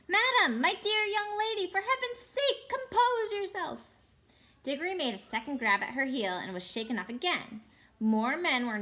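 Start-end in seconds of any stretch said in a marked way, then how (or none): 0.1-3.8 put-on much higher, panicky old man's plea; trembling scream. Uncle Andrew begging the witch, high trembling scream. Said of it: "in a sort of trembling scream".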